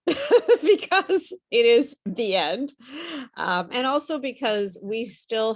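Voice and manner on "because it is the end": amused, laughter breaking into the words; laughing. Real, not acted. Teacher jokes with class about the last lesson, laughing. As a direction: chuckle through it, higher and slower. Chuckling at own theme joke.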